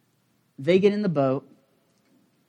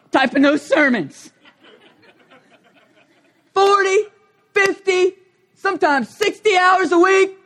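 high, loud mock-indignant father; exasperated dad rant; 13 semitones higher, 6 dB louder than usual. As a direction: ham up the overworked dad. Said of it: "they get the speech".

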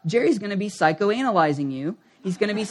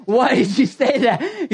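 slow, higher-pitched mock scolding; exasperated; 5 semitones higher, 4 dB louder, slower than usual. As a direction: drag out the question in exasperation. Imagined quarrel with his wife.

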